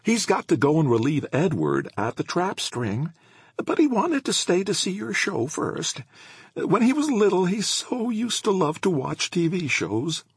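put-on chatty elderly woman; old woman voice. A narrator's reading. Voices Missus Howlett.

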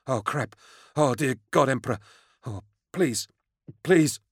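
high, panicky begging; fearful pleading. A narrator's reading. Frightened man begs an armed stranger, fearful pleading. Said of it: "pale with fear".

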